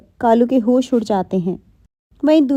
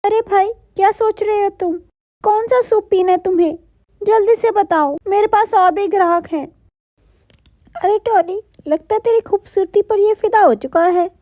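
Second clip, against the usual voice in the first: pitched way up, quick and chirpy shopkeeper; high Tony bird voice; 8 semitones higher than usual. Acted. Shopkeeper bird teasing Kalu, in a high voice. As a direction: play a busy bird hurrying her customer, high.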